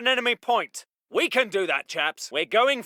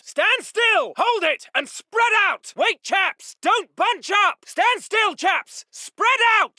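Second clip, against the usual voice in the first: high, urgent shout; shouted halt; 9 semitones higher, 4 dB louder, slower than usual. Acted. Officer halting everyone at once, shouting. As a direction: yell to stop them all. Officer halts and spreads his men.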